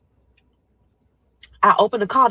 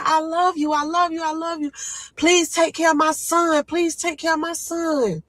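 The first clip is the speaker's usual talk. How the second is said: tearful goodbye; 9 semitones higher than usual. Retold or imitated. Crying goodbye to family.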